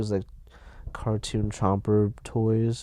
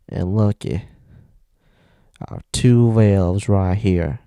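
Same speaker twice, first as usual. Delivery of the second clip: Southern man impression; 8 dB louder than usual. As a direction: play a Southern crematorium worker, loud. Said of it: "Here's my impression of a Southern man".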